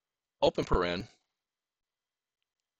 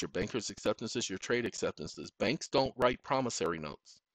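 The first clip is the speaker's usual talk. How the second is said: ordinary delivery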